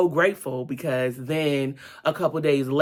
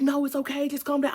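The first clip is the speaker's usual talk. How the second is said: high-pitched voice of others calming her; imitated reassurance; 12 semitones higher, faster than usual. Onlookers calming a swimmer on the ladder, imitated high reassurance. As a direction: quote them soothing her, pitched well up.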